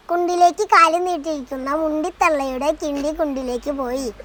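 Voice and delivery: rattled off fast in one breath; fast tongue twister. Shown as a demonstration. Child rattling off a tongue twister for the parent, fast. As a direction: race through the tongue twister at speed. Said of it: "നാല് വട്ടം".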